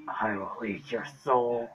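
slow, drawn-out demonic voice; possessed. Pretend. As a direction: drag it out like a haunted spirit. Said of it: "Being creepy is my thing".

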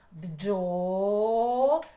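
Бджола in two loud, long-held syllables; split into syllables. Shown as a demonstration. Teacher tries dividing the word for pupils, Бджола loud and syllable by syllable. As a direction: stretch each vowel: бджооо, лааа. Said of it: "це слово на склади чи ні".

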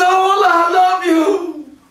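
high, loud, joyful vow to God; the soul in paradise calling out its love to Allah, ecstatic; ecstatic devotion. Acted. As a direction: pour out love with a laugh in it. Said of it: "It was".

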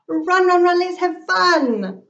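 high, loud sing-song call; excited chant to race. Acted. Child calling out to animal.

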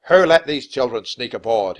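loud, stern demand. A narrator's reading. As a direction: bark it like a commander rebuking the crew. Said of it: "in a stern voice".

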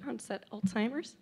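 fighting back tears mid-sentence; choked up, trying to keep talking; real crying. Real, not acted. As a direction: voice catching with tears. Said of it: "so I don't know why I'm crying".

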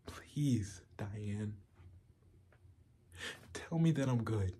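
hushed, desperate plea; whispered begging. Acted. Begging Diane to tell him he's good, whispered pleading. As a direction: beg softly, fading into a bare whisper.

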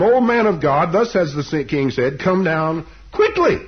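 loud, high-pitched acted order; imperious mock summons; commanding. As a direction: proclaim it slowly, punching the last word. Second captain's order acted out.

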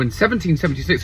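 ordinary delivery

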